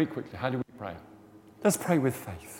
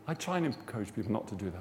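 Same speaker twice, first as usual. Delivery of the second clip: ordinary talk, nothing marked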